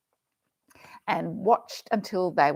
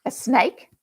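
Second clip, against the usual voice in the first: ordinary delivery, nothing marked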